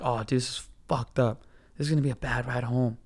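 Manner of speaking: low, soft aside to himself; muttered dread. Retold or imitated. Passenger foreseeing a bad ride home, quiet dread.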